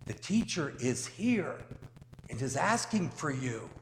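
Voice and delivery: measured, solemn gospel reading voice; scripture reading. Acted. Priest reading the gospel to the congregation, solemn.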